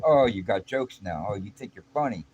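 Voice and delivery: man taunting the narrator, sneering; mocking threat. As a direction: needle him before the fight. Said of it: "he's like".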